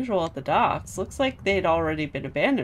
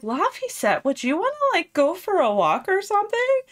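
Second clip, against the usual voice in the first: higher, coaxing mock Eleanor voice; sweet-talking Eleanor; 12 semitones higher than usual. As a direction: lure him out with a falsely sweet ask. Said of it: "she's all like".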